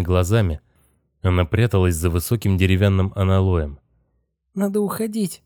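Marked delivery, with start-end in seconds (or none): 4.5-5.4 put-on high, cracking girl's voice, softer; voice breaking. A narrator's reading. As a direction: let the voice crack with fear. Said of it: "сказала Китти срывающимся голосом".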